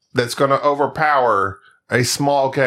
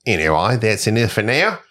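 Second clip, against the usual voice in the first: put-on Aussie accent; Australian accent. Reading a listener's email to his co-host, Australian accent. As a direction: read it out in Australian. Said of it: "I absolutely love doing the Australian accent".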